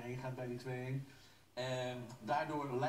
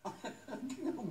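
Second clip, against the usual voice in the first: giggle; 8 semitones higher than usual. Real, not acted. Laughs again at colleague.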